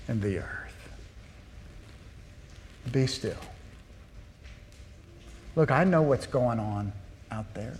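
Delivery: calm them with hushed authority; quiet voice of God. Acted. God speaking to the frightened psalmist, hushed and calm. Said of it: "And yet God says to the psalmist".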